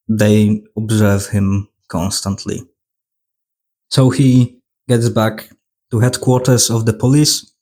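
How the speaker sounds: normal talking voice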